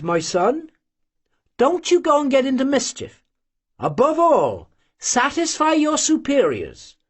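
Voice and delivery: put-on a mother cautioning her young son; old mother's advice.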